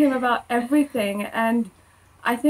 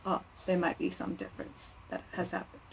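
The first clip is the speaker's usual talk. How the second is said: very soft, lower musing; quiet realization; 4 semitones lower, 15 dB quieter than usual. Retold or imitated. Believer looking back and noticing a change, quiet realization. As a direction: think it aloud, barely voiced.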